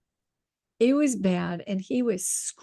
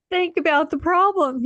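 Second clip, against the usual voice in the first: high, loud, barely holding back laughter; laughing through it; 9 semitones higher, 6 dB louder than usual. Retold or imitated. Coaching the laughing colleague, giggling through words. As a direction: try to say it while cracking up. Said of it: "we're hysterical laughing".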